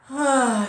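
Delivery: big, loud acted sigh; high falling "ahh" of relief. Escaping the party into the hallway, loud sigh of relief. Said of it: "you sigh, you know, a breath of relief".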